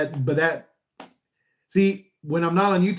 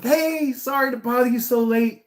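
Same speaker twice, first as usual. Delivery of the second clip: much higher, smiling and apologetic; smiley nice greeting; 9 semitones higher, 3 dB louder than usual. Retold or imitated. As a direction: greet them with a big friendly smile. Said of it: "And I'm smiley and I'm nice".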